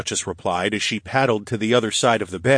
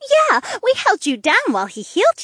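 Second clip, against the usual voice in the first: put-on high, loud bright girl; chirpy and eager; perky girl voice; 5 dB louder than usual. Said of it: "Trina explained".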